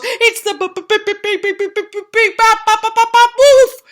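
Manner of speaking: high, loud stammering panic; chattering with fear. Acted. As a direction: teeth chattering, stutter it out. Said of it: "he chattered".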